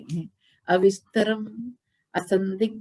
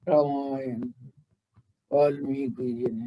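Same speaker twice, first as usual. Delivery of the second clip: much lower, hollow rumbling voice; low pitch demo; 8 semitones lower, 4 dB quieter than usual. Shown as a demonstration. Guru mocks speakers who talk in a very low pitch. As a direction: drop way down like a bass belch. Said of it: "या low pitch में?".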